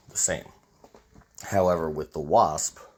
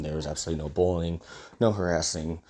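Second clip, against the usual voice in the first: usual speaking voice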